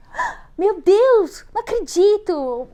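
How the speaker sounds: high, slow drawn-out "meu Deus"; amazed gasp. Retold or imitated. People reacting to her trip, astonished. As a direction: gush like hearing big news. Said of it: "As pessoas falam".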